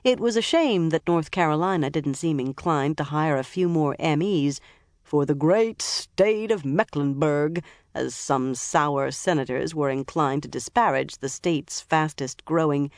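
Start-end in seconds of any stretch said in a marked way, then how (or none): 5.0-7.6 mock senator. A narrator's reading. Mocking senators' phrase.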